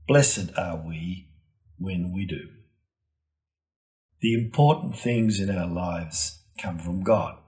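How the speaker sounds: ordinary talk, nothing marked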